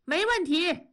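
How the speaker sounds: high, eager call back; excited reply. A narrator's reading. Worker answering her invitation, excited high call. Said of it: "同志兴奋地答应着".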